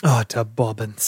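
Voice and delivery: slow mock British "Utter bobbins"; echoing the British phrase; British accent. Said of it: "That's Elton".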